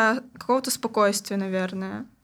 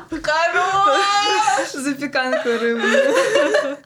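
laughing; 7 semitones higher, 4 dB louder, much slower than usual. Real, not acted. Laughing fit over a joke.

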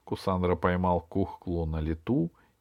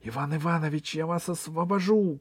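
high, hushed urgent call; girl calling out; 6 semitones higher than usual. Acted. Алиса rushes to free the director, high urgent call. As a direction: promise rescue in a small high voice. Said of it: "крикнула она".

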